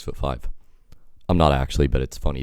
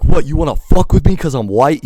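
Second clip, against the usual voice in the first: high, loud confrontational yell; furious challenge; 11 semitones higher, 10 dB louder than usual. Retold or imitated. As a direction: fire it back, yelling. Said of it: "I'm like".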